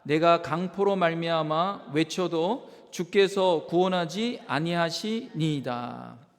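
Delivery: loud, slow, measured scripture reading; deliberate, projected recitation; scripture reading aloud. Real, not acted. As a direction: lead a congregation reading the verse together, loudly. Leads congregation in reading scripture.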